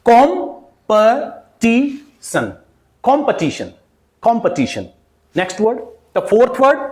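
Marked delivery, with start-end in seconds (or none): 0.0-2.5 "Competition" very slowly as kom, puh, tee, sun; long gaps between all syllables of "Competition"; syllable-by-syllable demo. Shown as a demonstration. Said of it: "how you will pronounce this word". 3.0-4.9 "competition" as KOM-puh-TI-shun, twice; correct pronunciation demo. Shown as a demonstration. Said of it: "how you will pronounce this word".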